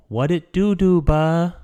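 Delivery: high, loud goofy greeting voice; silly voice. Acted. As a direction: hype it up in a funny character voice. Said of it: "I just said it in the voice".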